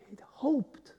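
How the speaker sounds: hushed, voiceless whisper as if sharing a secret. Acted. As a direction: drop to a bare whisper to land the point. Whispered reveal of Jonah's motive.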